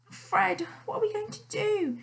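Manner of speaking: wife wailing to Fred in dismay; wailed. A narrator's reading. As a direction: cry out to her husband in despair. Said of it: "she wailed".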